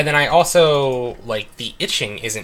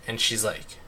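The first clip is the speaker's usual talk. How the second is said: ordinary delivery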